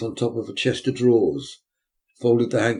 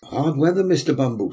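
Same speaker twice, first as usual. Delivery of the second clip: brisk matron, slightly raised; 5 semitones higher, 5 dB louder than usual. Acted. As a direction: speak up as the workhouse matron.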